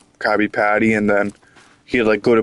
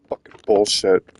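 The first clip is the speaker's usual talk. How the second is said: normal talking voice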